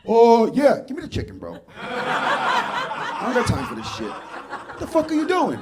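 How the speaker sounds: loud, high, exasperated rant; angry at delivery guy. Retold or imitated. Comic yelling at a late DoorDash driver, high exasperated rant. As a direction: berate the late driver, laughing. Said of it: "Pulled up going".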